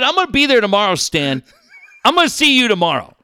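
reenacted furious threat to the quarterback; angry threat. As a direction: bark it at him, fast at the end. Retold threat to Stan.

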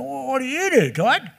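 broad Aussie bloke asking a question; a friendly Australian tradie asking what you do. Retold or imitated. Shop guy in Perth asking the pastor, broad Australian. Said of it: "real Aussie fella".

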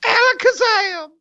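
high, hammed-up spell cry; Harry Potter impression. Cohost's turn at the Radcliffe voice, a high spell cry. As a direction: call out a magic word like a boy wizard. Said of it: "Daniel Radcliffe, go".

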